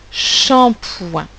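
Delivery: shampoing stretched out syllable by syllable; dictation pace. Shown as a demonstration. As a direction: draw out both halves of shampoing. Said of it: "écrire le mot shampoing".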